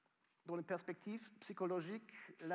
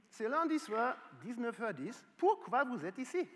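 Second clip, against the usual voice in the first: pourquoi pushed higher and louder; emphatic louder repeat; 6 semitones higher, 8 dB louder than usual. Real, not acted. Professor presses the audience on why they came, louder and higher. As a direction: raise the voice and hammer the question home.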